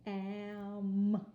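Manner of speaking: loud, drawn-out am with a long m; stretched am. Shown as a demonstration. Teacher sounding out the ending of ham for kids, drawn out and loud. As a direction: hum the m in am. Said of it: "Kinda hard for you to hear me say it".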